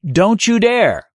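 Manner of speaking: put-on a restrained cry of protest from a small boy; mock scream. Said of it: "a voice screams at you".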